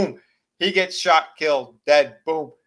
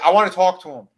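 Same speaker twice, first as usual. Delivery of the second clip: louder, higher demand; raised voice; 6 semitones higher, 9 dB louder, faster than usual. Retold or imitated. Dave pushing past the deputy, raised voice. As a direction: insist on being heard.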